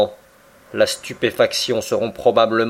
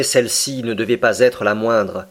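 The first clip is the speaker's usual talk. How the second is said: usual speaking voice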